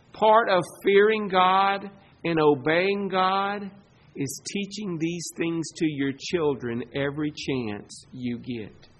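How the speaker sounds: ordinary delivery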